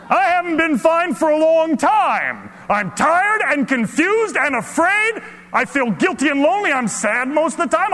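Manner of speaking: high, loud desperate outburst; yelling out. Acted. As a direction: shout your confession to the whole room. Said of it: "I yell out".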